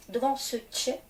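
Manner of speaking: ordinary delivery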